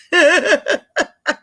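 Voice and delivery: bursting high laugh; giddy rising laugh. Real, not acted. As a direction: cackle with delight.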